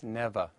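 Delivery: "never" ending in a plain schwa, no R sounded; never without R. Shown as a demonstration. Teacher shows "never" said alone, with no R. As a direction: drop the final R in "never". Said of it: "in isolation or at the end of an utterance".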